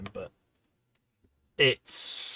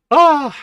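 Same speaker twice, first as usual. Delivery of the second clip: sudden loud high yelp; a sharp cry as the lid gives way; surprised yelp; 19 dB louder than usual. Real, not acted. Lid finally pops.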